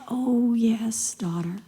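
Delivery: faint whispered reply; barely able to speak; weak dying queen. Acted. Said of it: "dying queen answers faintly".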